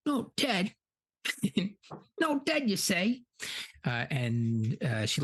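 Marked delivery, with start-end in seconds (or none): 0.1-3.2 gleeful high villain. Retold or imitated. Shelob/orc voiced, gleeful.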